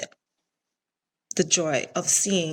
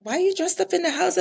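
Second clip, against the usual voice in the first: high, quick, puzzled question as the son; son's surprised question; 11 semitones higher, faster than usual. Retold or imitated. Mom retelling her son's puzzled question, high and quick. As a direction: mimic the son's surprise, pitched up and fast. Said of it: "he's like".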